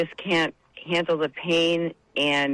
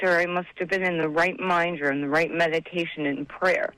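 ordinary talk

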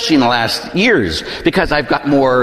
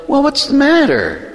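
high-pitched, startled pastor asking a crying woman; concerned pastor; 11 semitones higher than usual. Retold or imitated. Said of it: "this woman comes in and she's crying. And he goes".

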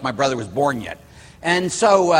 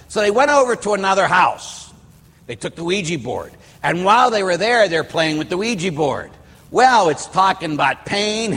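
ordinary talk, nothing marked

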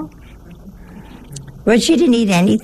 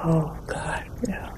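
soft, shocked little gasp of words; a guest quietly aghast at the table; imitating a horrified guest, barely audible; horrified murmur; 4 semitones lower, 12 dB quieter, slower than usual. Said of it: "She was horrified".

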